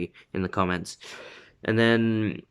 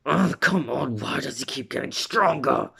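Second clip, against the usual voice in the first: higher-pitched mock outburst; frustrated rival; 5 semitones higher than usual. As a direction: imitate a hot-headed rival fuming. Acting out angry rival Bakugo.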